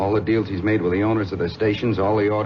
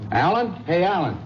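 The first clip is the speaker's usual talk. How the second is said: calling across the garage, higher; 8 semitones higher than usual. Acted. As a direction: call a friend over from a distance. Calling a friend across shop.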